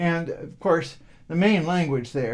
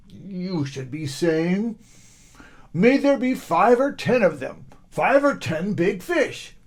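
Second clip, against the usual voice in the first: fisherman's instruction; 5 semitones higher, 4 dB louder than usual. Acted.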